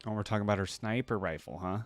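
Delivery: ordinary talk, nothing marked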